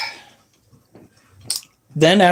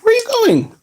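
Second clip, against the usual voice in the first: very high squeaky question to a pet; much faster than usual. Real, not acted. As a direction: coo at the runaway scorpion. Talking to the escaping scorpion.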